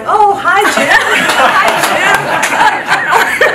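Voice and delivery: high, loud mock-cheery hello; cheery greeting. Passengers greeting Jim on the boat, hammed-up cheer. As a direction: sing out a friendly hi between laughs. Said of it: "people would be like".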